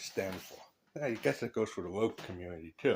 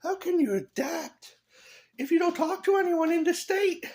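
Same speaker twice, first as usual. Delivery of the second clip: much higher, louder mocking squeal; mock retort; 5 dB louder than usual. Mocking the other side's retort, much higher and louder. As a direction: fire back in a shrill parody. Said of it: "And she's like".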